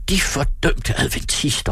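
high, slow angry exclamation; angry shout. A narrator's reading. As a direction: cry out in anger, drawing it out. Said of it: "råbte han".

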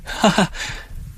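high, quiet breath of laughter; a laugh escaping unbidden; high involuntary giggle; uncontrolled laugh. A narrator's reading. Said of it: "Hvem er det, der ler".

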